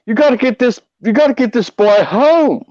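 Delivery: a lighter replay of the Texas trooper twang; Texas cop. Retold or imitated. Trooper ordering the boys home, lightly voiced Southern twang.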